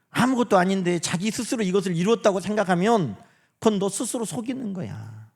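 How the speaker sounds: ordinary talk, nothing marked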